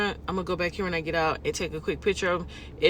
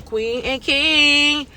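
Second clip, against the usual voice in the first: slow, drawn-out and louder, showing off the shirt; proud showing off; 8 semitones higher, 6 dB louder, much slower than usual. Real, not acted. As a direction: announce it slowly like a proud title.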